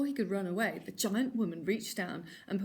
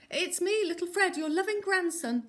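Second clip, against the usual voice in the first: higher, sweet put-on little boy; best boy voice; 9 semitones higher, 5 dB louder than usual. A narrator's reading. Wolf pretending to be Fred at granny's door, higher boy voice. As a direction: fake a loving grandson at the door. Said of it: "in his best boy voice".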